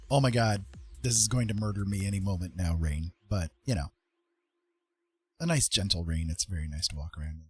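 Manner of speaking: ordinary delivery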